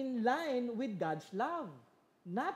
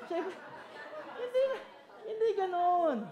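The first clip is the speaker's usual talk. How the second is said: laughing; 11 semitones higher, 4 dB louder than usual. Real, not acted. Preacher laughing at his own spanking gesture. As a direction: burst out laughing on it.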